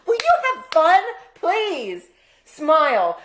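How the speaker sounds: high pleading coax; playful coaxing. Real, not acted. Wife coaxes sulking husband to smile, playful pleading.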